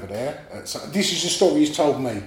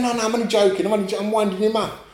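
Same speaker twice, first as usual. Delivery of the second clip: high, quick backpedalling lad; 7 semitones higher, faster than usual. Retold or imitated. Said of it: "He went, he's going".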